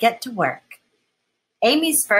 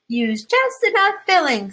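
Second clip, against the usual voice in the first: mom teaching; 11 semitones higher, 4 dB louder than usual. Acted. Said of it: "says Amy's mom".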